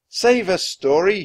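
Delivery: loud, high plea; desperate cry. A narrator's reading. Jack pleading with the story in the storm, crying out. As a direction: cry out for rescue. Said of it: "he cried".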